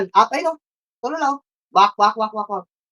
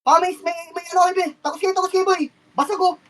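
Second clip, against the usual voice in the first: much higher urgent calls in the fight; high-pitched excited battle orders; frantic high shotcalling; 11 semitones higher than usual. Real, not acted. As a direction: drive the team through the fight. Peak of team fight.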